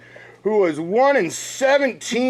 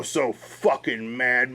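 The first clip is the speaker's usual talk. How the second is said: slow, much softer picture-book sulk; storybook reading voice; 6 semitones lower, 6 dB quieter, slower than usual. Pretend. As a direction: read it slowly and softly like a sad storybook line. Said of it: "read a little thing called I Was So Mad".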